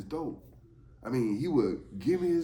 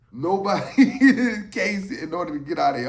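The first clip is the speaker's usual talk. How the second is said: laughing through the words; 6 semitones higher, 11 dB louder than usual. Retold or imitated. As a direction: chuckle while saying it. Laughs mid-quote.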